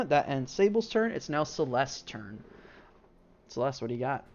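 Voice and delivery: normal talking voice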